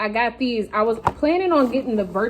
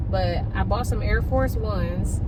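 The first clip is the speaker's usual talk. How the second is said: ordinary delivery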